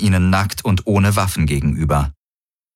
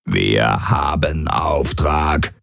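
much lower, slow, croaked and burped; croaking, belching Kilfer; 4 semitones lower, much slower than usual. A narrator's reading. Kilfer speaks again.

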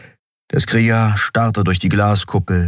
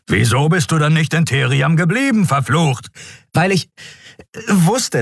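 raised, ranting voice; shouting; 10 semitones higher than usual. A narrator's reading. Keru shouts at Endriel, blaming her, raised voice. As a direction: rage at her for dragging them in. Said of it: "kannst du nicht auch mit normaler Lautstärke reden".

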